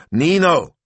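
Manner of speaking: loud high call across the room. A narrator's reading. Betta calling to Nino across the tent, loud call. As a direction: call his name from afar.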